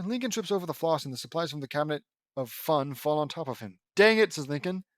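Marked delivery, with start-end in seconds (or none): 4.0-4.3 loud, high burst of frustration; frustrated. Acted. Lincoln tripping over floss, frustrated. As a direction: blurt it out in annoyance.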